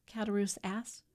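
plain reading voice

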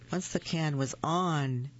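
plain reading voice

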